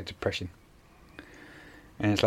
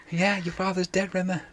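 lightly voiced, higher, blunt; higher character voice; 9 semitones higher than usual. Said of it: "quoted character line".

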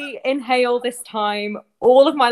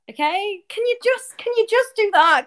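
usual speaking voice

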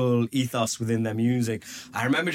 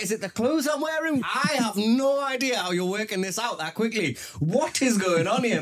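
baffled disbelief; 9 semitones higher than usual. Retold or imitated. Retold baffled reaction.

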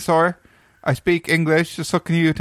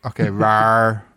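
dinosaur voice; 6 semitones lower, slower than usual. Acted. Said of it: "So I'm just speaking dinosaur".

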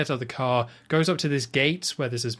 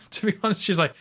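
ordinary talk

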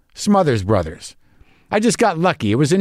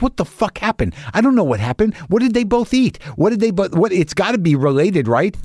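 higher, panicky questions tumbling out; panicked; 7 semitones higher than usual. Retold or imitated. Pet owner retelling his panic over two sick cats, mildly frantic. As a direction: replay the alarm of finding both cats sick. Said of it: "I'm like".

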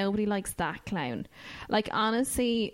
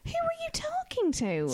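much higher, squealed question; shocked question. Real, not acted. Host horrified that her friend rang the school, much higher squeal. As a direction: shriek it in disbelief.

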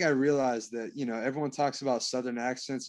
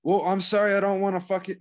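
annoyed; 6 semitones higher, 5 dB louder than usual. Real, not acted. Defensive back-and-forth with friend.

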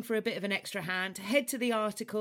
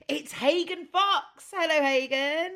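higher and louder, a slow grand welcome; showbiz announcement; 7 semitones higher, 7 dB louder than usual. Acted. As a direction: present the guest like a game show host. Host announces the guest.

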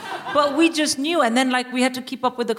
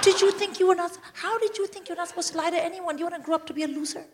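high, rapid hissed rebuke; acted exasperated parental rant; scolding mother; 7 semitones higher, 3 dB quieter, faster than usual. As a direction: rattle off the telling-off, high and fast. Mother scolding her crying daughter.

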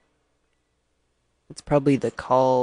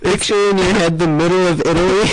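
shouting; 2 semitones higher, 5 dB louder than usual. Pretend. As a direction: yell it at full blast for laughs. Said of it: "Why is being loud so funny?".

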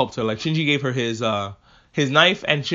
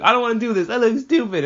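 whiny Asuka; 7 semitones higher than usual. Retold or imitated. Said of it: "Asuka's like".

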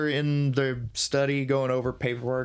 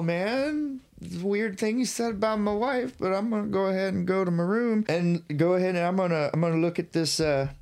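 nervous Thomas voice; 6 semitones higher than usual. Retold or imitated. Thomas voiced, unnerved by host.

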